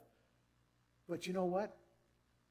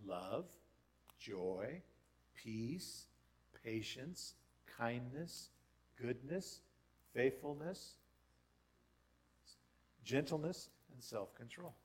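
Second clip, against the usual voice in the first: each fruit named slowly with a small lift; slow measured list; 3 semitones lower, 4 dB quieter, slower than usual. Shown as a demonstration. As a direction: lay the list out calmly, one word at a time. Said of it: "what are they?".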